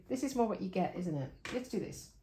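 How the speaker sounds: ordinary delivery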